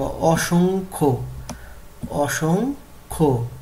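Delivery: অসংখ্য drawn out as o-shong-kho; slow pronunciation model. Shown as a demonstration. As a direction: break অসংখ্য into long, slow syllables. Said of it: "এটা হচ্ছে সঠিক উচ্চারণ".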